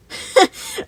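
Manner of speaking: high, loud breathy cackle; mocking cackle. A narrator's reading. Moon scoffs at the boy with a loud, high cackle. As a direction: cackle sharply at the protest.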